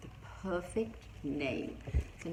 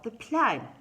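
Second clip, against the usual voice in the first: urgent call; 5 semitones higher, 9 dB louder than usual. Acted. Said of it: "cried Owl".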